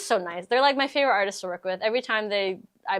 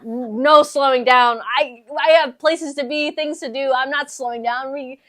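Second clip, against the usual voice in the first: excited; 5 semitones higher, 8 dB louder than usual. Real, not acted. Hyped about fast year ahead.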